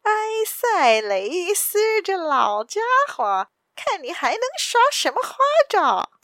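loud, high-pitched gloating villain; cold sneer. A narrator's reading. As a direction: taunt the captive with a sneer, pitch soaring. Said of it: "那领头的人冷笑道". (高声冷笑着嘲讽)

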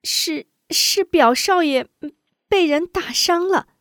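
high halting maid's voice; timid faltering maid; hesitant stammer. Acted. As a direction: stammer it out fearfully. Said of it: "吞吞吐吐道".

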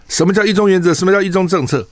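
high, pointed mock question from a student; quizzing student. Student testing Hou at a university, high pointed quiz. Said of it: "学生就问他".

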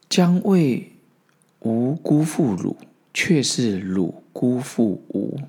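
slow, measured reading of an ancient saying; solemn recitation. Acted. The master pronouncing his verdict in a slow solemn recitation. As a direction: intone it like scripture, drawing each word out.

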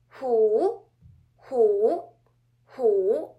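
"hu" loud, dipping then rising; third tone. Shown as a demonstration. Teacher giving the word for tiger, loud dipping and rising third tone. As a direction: project "hu" down and back up. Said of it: "word with the third tone is".

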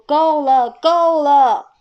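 大声喊够了; 用力喝止; 大声喊停. Acted. 爸爸喊停. (大声喝止)

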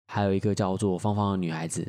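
ordinary talk, nothing marked

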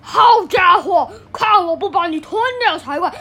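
troll shout. A narrator's reading. Troll shouts at the big goat, loud. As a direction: shout the threat.

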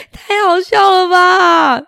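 high laughing squeal into the words. Real, not acted. Storyteller laughs with the children, high and amused. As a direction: laugh out loud at the silly wolf.

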